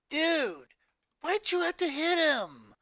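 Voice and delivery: put-on high, exasperated girl voice; Luna scolding. A narrator's reading. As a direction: scold him like a fed-up older sister. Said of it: "Luna scolded".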